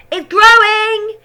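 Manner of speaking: put-on high, loud, slow yell of a thrilled boy; excited yell. A narrator's reading. George yelling to Grandma about the hen. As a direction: yell it in delight. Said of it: "he yelled".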